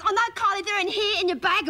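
high, mocking classmate voice; mimicked classmate. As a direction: pitch it up as the helpful tattletale. Classmate voiced exposing her excuse.

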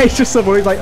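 ordinary talk

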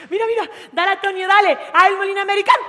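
high, loud cheering on; excited cheering. Retold or imitated. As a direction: egg him on excitedly. Animando al novio a bailar. (animando a gritos a su novio)

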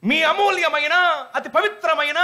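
high-pitched campaign announcer imitation; election announcer. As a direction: mimic the election loudspeaker guy.

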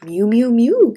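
high, loud mewing; kitten's cry. A narrator's reading. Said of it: "came a cry from above".

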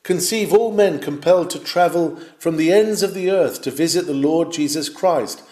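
normal talking voice

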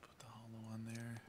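soft unvoiced whisper. Real, not acted. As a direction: whisper it to yourself.

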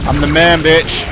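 loud boastful yell; cocky shout. Real, not acted. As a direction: brag it out loud. Boasting at the game.

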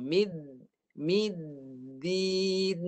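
"middin" with the d stopped and held before release; held dal. Shown as a demonstration. Teacher shows tongue at upper teeth, held d. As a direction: hold the d of "Mid", then release a long "din". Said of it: "Ditahan dulu".

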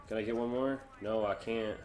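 ordinary delivery

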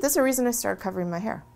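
ordinary delivery

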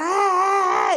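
high, drawn-out mock yell; mimicked yelling. Angry driver starts in on him, mimicked yelling. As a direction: imitate a driver yelling out the window.

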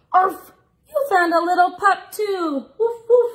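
high, loud puppy voice with a gasp; little pup. Acted. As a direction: yap like an excited little puppy. Pup barks in picture book.

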